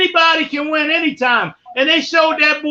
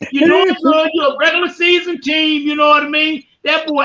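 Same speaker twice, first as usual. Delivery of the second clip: usual speaking voice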